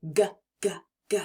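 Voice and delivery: whispered hard "g" sound, breath only; a teacher modelling the "g" sound in a whisper; g sound demo. Shown as a demonstration. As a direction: breathe out "G, g, g" from the back of the throat. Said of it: "make the sound that the G makes".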